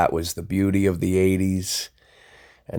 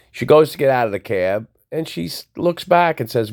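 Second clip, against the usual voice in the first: normal talking voice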